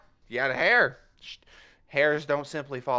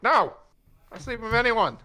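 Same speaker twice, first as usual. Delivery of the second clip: higher, louder outraged denial; indignant protest; 10 semitones higher, 4 dB louder than usual. Acted. Puss protesting hotly to Zenith about who he sleeps with. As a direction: fire back a high shocked no. Cat character denies loudly.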